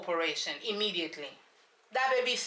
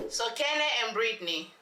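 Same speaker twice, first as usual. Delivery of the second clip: ordinary delivery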